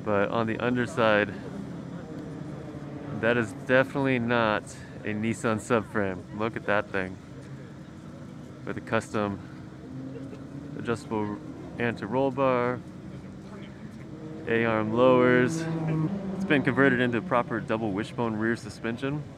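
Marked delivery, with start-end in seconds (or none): none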